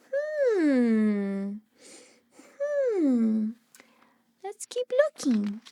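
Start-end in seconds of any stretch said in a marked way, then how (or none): none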